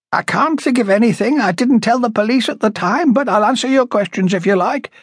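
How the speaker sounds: high raspy woman turning meek; meek, high-pitched, rasping. A narrator's reading. Woman agreeing to answer police questions, meek and high-pitched. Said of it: "meekness in her high-pitched, rasping voice".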